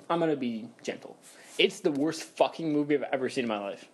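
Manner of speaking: normal talking voice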